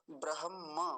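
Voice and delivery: ब्रह्म as bra-ham-maa, h before m; correct pronunciation. Shown as a demonstration. Teacher giving the corrected word, correct pronunciation. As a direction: end ब्रह्म on a full maa. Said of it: "सही क्या होगा".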